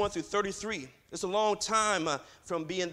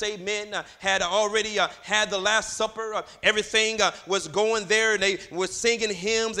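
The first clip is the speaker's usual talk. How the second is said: loud, rhythmic sermon cadence; driving preacher's chant-like delivery; full-force preaching; 3 semitones higher, 6 dB louder than usual. Acted. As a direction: push out short phrases with gasped "uh" and "amen" between.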